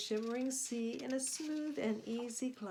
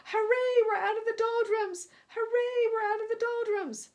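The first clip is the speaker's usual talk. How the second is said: much higher and louder joyful hooray; cheering shout; 12 semitones higher, 9 dB louder than usual. A narrator's reading. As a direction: cheer like a crew freed from the calm. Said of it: "everyone is shouting".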